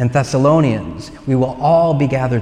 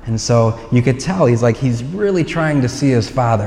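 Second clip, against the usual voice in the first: ordinary delivery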